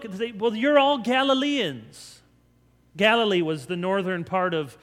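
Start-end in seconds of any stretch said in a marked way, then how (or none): 0.3-2.2 raised, louder, drawn-out disbelief; astonished onlookers. Acted. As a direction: voice a baffled crowd, high and slow. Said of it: "they, they, they look".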